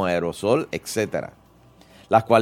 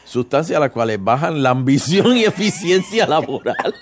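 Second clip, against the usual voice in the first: laughing while reading; 4 semitones higher, 4 dB louder than usual. Real, not acted.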